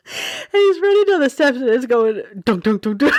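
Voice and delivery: high, breaking up with laughter; words squeezed out through giggles; laughing while telling. Real, not acted. Mom telling viewers the dildo story, laughing hard.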